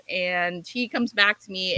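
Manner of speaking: ordinary talk, nothing marked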